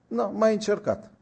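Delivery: the preacher answers the youth's excuse with irony; wry. Retold or imitated. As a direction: toss it off with irony.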